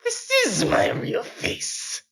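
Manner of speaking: very high, slow, airy villain voice; a sly laughing taunt. Acted. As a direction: assassin mocks the cowboy's confusion. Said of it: "Silent laughed".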